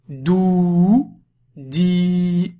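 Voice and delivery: "dou, di" with each vowel held long. Shown as a demonstration. Teacher showing long vowels to a learner, "dou, di" drawn out. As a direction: stretch out the ou and i of "dou, di". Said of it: "on passe aux voyelles longues".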